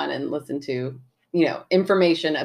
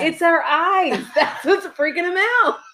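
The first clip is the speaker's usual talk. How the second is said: high laughing words, almost giggling through them; 12 semitones higher than usual. Real, not acted. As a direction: laugh through the joke as you say it. Joking about sunglasses, laughing.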